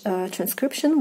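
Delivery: ordinary delivery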